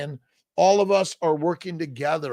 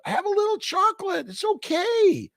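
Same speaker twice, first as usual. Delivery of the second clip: playful falsetto. Pretend. To a viewer craving chocolate, in a teasing falsetto. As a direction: coax them in a silly high voice.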